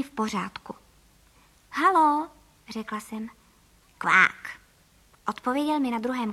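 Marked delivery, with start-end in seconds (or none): 1.7-2.3 higher, raised hello; questioning telephone greeting; calling into a phone. A narrator's reading. Narrator calls into telephone. 4.0-4.6 low, abrupt quack; imitated duck call. A narrator's reading. Duck voice answers the phone.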